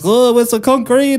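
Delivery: put-on comic Greek Australian accent, pitched high. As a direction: bark it like a Greek Australian tradie. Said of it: "all those jokes are in there".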